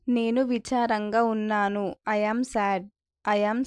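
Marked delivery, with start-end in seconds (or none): none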